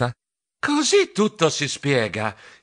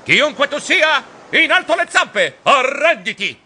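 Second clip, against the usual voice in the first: shouting; 10 semitones higher, 4 dB louder than usual. Acted. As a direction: yell to catch the ghost. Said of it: "Mi affacciai gridando".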